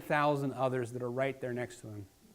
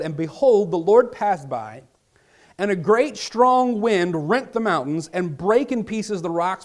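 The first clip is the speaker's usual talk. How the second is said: forceful scripture reading; 2 semitones higher, 7 dB louder than usual. Acted. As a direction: proclaim each line to the congregation. Preacher reads scripture aloud.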